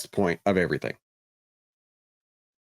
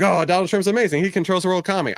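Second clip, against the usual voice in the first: mock gushing fan; 8 semitones higher, faster than usual. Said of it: "I don't mean to be like".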